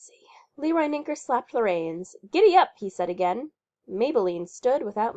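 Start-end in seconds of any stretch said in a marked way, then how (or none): 2.3-2.7 Giddy up called out higher and louder; loud urging. Acted. Leroy urging his horse to move, loud and high. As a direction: urge the stubborn horse on.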